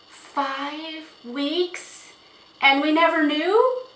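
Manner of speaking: high, incredulous questions rising at the end; stunned disbelief. A narrator's reading. Mother learning of five weeks of secret rides, astonished. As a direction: echo it in astonishment, pitch climbing.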